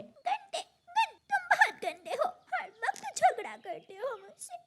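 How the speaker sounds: put-on very high, whiny child-bird voice; tearful scolding. Little sister to brother who threw her toy, tearful scolding. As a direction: sob out a childish accusation.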